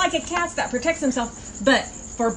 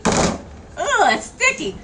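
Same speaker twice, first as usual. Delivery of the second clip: higher-pitched icky reaction; disgusted; 7 semitones higher, slower than usual. Real, not acted. Host finds sticky peanut butter, squeamish disgust. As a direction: recoil from something gooey.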